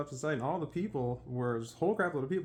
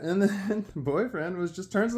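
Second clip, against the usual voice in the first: ordinary delivery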